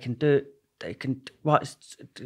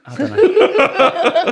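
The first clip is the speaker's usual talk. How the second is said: high, slow, dissolving into laughter; can't hold it together; laughing; 11 dB louder, much slower than usual. Real, not acted. Shrugging off the Scouse impression request, laughing.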